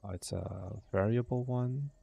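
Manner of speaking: ordinary delivery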